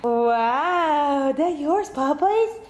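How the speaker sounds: high cooing question to the dog; dog talk. Real, not acted. Talking to dog.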